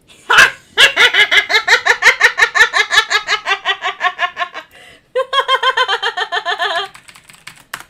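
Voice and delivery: long, loud, high laughing fit; real high-pitched cackling laugh; explosive laughter. Real, not acted. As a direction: burst out laughing hard and keep going. Bursts into laughter at editor's message.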